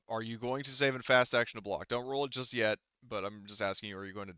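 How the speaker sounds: normal talking voice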